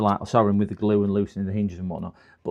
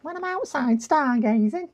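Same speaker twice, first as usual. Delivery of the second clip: Worms impression. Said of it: "how he sounds".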